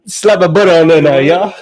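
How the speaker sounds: high, loud hammed-up Cajun chef; over-the-top Louisiana accent; Cajun chef impression. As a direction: bellow it like a TV Cajun cook. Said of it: "the Louisiana Chef".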